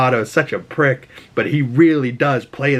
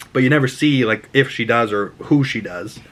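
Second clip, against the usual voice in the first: ordinary talk, nothing marked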